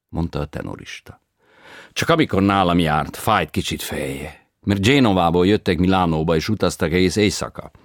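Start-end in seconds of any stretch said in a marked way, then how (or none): 1.9-7.7 foreign tenor voice. A narrator's reading. Tenor reports, broken Hungarian.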